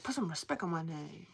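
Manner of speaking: imitating the rapper. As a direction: quote the rapper with a mock edge. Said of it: "the rapper where he's like".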